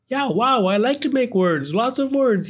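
high, giddy little creature; bubbling with glee; gleeful firefly. Acted. Gus gleeful.

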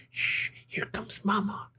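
urgent whispered hiss. A narrator's reading. Baby bird warning siblings Mama is coming, whispered hiss. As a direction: hush the others with a breathy warning. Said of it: "hissed Pip".

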